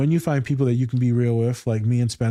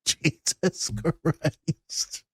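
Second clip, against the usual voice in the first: laughing; 3 semitones higher, 5 dB quieter, much slower than usual. Real, not acted. Laughing exasperation.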